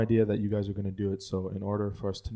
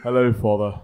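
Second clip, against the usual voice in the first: put-on louder, proper English-accented baby greeting; 8 dB louder than usual. Baby's first words to father, louder proper English accent. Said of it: "You have the same voice and accent".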